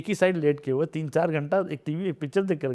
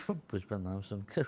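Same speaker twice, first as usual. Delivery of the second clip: low, soft, half-laughing mock flirting; cute, flirty; 5 semitones lower, 10 dB quieter than usual. Guest trying a film line on request, mock flirty. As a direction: play coy and flirty, quietly. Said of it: "ये cute और flirty अंदाज में".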